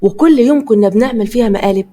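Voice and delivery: ordinary delivery, nothing marked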